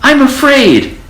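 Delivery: high, frightened squeak; clinging to mother; scared little voice. A narrator's reading. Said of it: "cried the little mouse".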